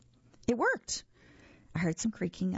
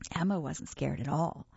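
plain reading voice

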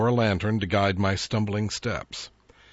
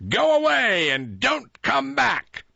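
high, loud, slow devilish snarl; devil's voice; 5 dB louder, slower than usual. A narrator's reading. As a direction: bar the door on Jack. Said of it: "the devil told Jack".

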